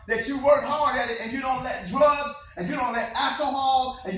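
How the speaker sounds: loud, building motivational preaching; rousing pep talk. Acted. Speaker rallying students to chase their goals, rousing and loud.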